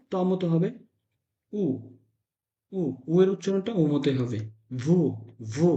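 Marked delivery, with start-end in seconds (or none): none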